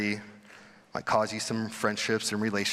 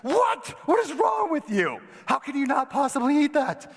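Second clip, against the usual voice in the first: shocked high shout, mocking the crowd; squealing mock outrage, much higher and louder; 4 dB louder than usual. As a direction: act out outraged disbelief at a vegan. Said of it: "What is the reaction of most people there".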